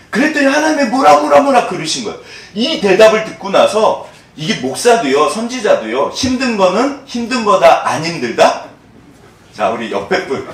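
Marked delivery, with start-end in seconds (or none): none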